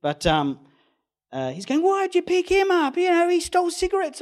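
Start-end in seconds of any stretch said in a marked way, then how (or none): 1.8-4.2 much higher and louder hammed-up yelling; mock angry yell. Station owner yelling at the driver, hammed-up angry shout. Owner yells about the hitchhiker.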